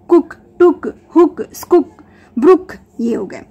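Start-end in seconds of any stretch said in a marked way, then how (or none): 0.1-1.9 teacher sounding out कुक, टुक, हुक, स्कुक with a short oo; clear teaching demo. Shown as a demonstration. Mom teaching her child short double O words, sounding them out crisply. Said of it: "जैसे बुक".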